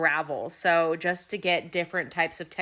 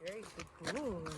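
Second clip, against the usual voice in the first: whisper-soft fond praise; tender praise; 15 dB quieter than usual. Real, not acted. Praising the horse softly.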